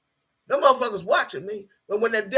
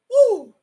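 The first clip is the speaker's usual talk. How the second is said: whoop of release; 13 semitones higher, 7 dB louder than usual. Real, not acted. Still shaken, releasing feeling.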